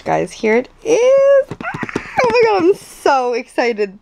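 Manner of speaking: high, loud burst of real excitement; excited squeal. Real, not acted. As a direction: squeal with joy at the unboxing. Unboxing her new camera.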